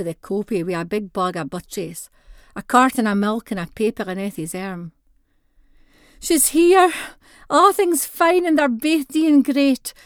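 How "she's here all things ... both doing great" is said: high, loud, slowed-down shouting of news; excited shout. Acted. Granny shouting the baby news to granda as he comes in, excited and loud. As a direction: call the good news across the house. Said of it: "Granny shouts the news at Granda".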